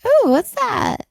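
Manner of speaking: high, drawn-out curious coo; naive curiosity. Pretend. Co-host imagines meeting a voodoo doll, naively curious. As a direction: play someone foolishly intrigued. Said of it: "be stupid enough to be like".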